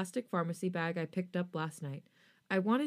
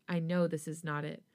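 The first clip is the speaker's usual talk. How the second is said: ordinary delivery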